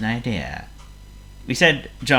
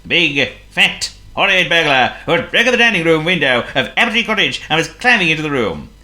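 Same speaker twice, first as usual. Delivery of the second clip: dramatic build; 6 semitones higher, 5 dB louder than usual. A narrator's reading. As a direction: paint the scary burglar big. Dramatic reveal of burglar.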